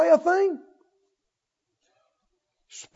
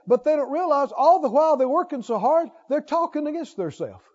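normal talking voice

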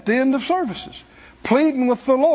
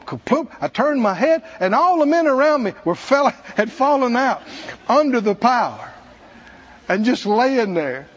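ordinary talk

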